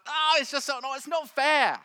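loud, high mock whine; whiny. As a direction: whine like a stroppy three-year-old. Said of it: "feel a bit whiny sometimes?".

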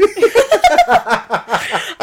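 high giggly silly voice; laughing. Pretend. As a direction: laugh through the silly phrase. Giggly silly wine banter.

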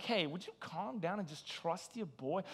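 smug reassurance. Shown as a demonstration. Preacher to wife and friends, soft knowing trust-me voice. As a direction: hush them like you've got it handled. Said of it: "I say it just like that".

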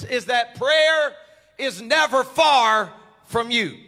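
loud, rising sermon preaching. Acted. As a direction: preach it with building fire. Preaching builds to full force.

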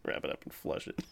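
very soft, voiceless wheeze of words; laughing with no voice left; breathless laughter. Real, not acted. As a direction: squeeze the phrase out while laughing. Repeating the joke while laughing.

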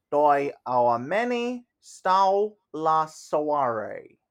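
slow, careful English tourist reading Romanian; halting phrasebook tourist. Acted. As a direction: sound out each Romanian word from a phrase book. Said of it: "and again in Romanian".

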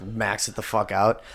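ordinary talk

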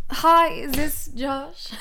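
slow put-on caller voice, laughter creeping in; silly phone voice. Shown as a demonstration. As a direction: rehearse a prank call in a stupid voice. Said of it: "My voice sounds so stupid".

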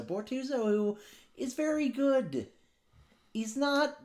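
high, sing-song mock praise; sarcastic compliment pitched high. Podcaster sarcastically praising defenseman, high mock voice.

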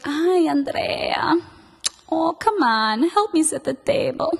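a hint of a pleading mother calling her daughter; mom's voice. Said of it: "That's her voice".

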